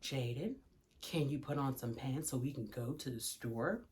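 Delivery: very low, hushed, over-polite mom; barely audible, low and controlled; soft sweet request. Acted. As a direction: play the patient mother, almost murmured. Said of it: "I could have been like".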